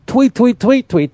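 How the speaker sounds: high mock tweeting; bird tweets. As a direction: chirp it like a bird. Imitating birds.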